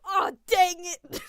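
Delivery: loud, high, drawn-out cry of frustration; imitating Deuce. Streamer echoing Deuce in the background, loud frustrated yell. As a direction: yell it slowly, imitating someone cursing in dismay. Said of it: "Deuce in the background go".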